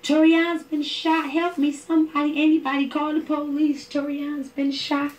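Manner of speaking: high, loud, panicked cry for help; desperate plea. Retold or imitated. Mother broadcasting for help after son is shot, panicked high plea. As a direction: beg anyone to call the police.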